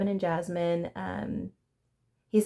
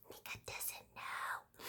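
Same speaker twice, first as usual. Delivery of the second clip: whisper; 17 dB quieter, slower than usual. Real, not acted. Aside about Mika's ignorance.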